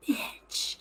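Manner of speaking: barely voiced whisper, half laughing; whispered self-insult. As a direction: whisper it under your breath. Mouths own thought under breath.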